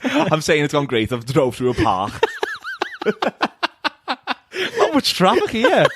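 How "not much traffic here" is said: high laughing examiner voice; cheery examiner. Pretend. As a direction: play a jolly examiner. Mock examiner comment.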